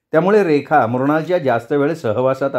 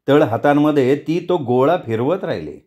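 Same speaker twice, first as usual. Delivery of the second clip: plain reading voice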